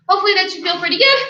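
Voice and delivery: ordinary talk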